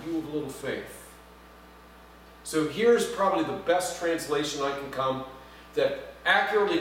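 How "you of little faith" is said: softer, tender rebuke; gentle, affectionate. Retold or imitated. Jesus speaking tenderly to Peter, soft and gentle. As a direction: say it like a fond parent. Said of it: "Jesus speaks this word".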